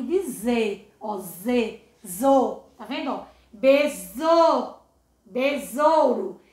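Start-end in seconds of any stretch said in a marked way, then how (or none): 3.5-6.4 besouro drawn out slowly and louder, the S buzzing like Z; slow Z sound. Shown as a demonstration. Teacher showing pupils the Z sound, slow and loud. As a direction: stretch besouro so the Z sound rings. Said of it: "Ele tem um som de Z".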